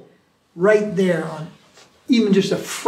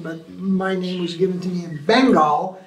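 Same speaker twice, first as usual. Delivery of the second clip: ordinary talk, nothing marked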